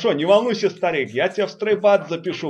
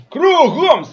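shouted command, higher and louder; drill sergeant; 7 semitones higher, 5 dB louder than usual. Acted. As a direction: bark the turn command.

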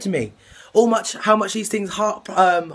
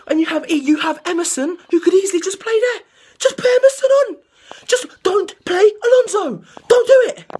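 high, louder and louder pleading; exasperated plea; 12 semitones higher, 4 dB louder than usual. Real, not acted. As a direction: beg the manager, climbing to a screech. Pleading with the manager over selection.

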